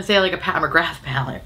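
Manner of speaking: ordinary talk, nothing marked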